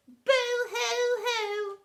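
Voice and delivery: mock sobbing. A narrator's reading. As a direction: wail a comic sob. Family weeping.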